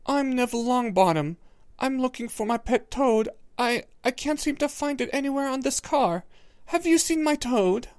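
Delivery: put-on small, high, timid boy; tiny voice. A narrator's reading. Neville at the door to Hermione, tiny and timid. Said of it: "in a tiny voice".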